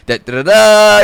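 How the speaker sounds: loud, high ta-da flourish; triumphant fanfare. Retold or imitated. Friend unveiling his bottle to the storyteller, triumphant. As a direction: sing out a proud reveal.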